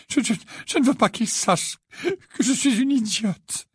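high, stammering, tearful confession; hiccuping sobs. A narrator's reading. Missus Wesley begs them to hide it, sobbing. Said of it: "octa Missus Wesley".

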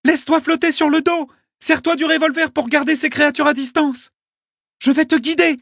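put-on high, loud urgent girl's voice; girl shouting orders. A narrator's reading. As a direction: shout instructions across the sea. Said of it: "cria-t-elle".

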